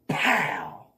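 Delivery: sudden explosive "pow"; imitated loud impact. Storyteller mimics the bungee hook's hit, imitated loud impact. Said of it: "And you heard that".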